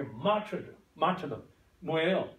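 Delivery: usual speaking voice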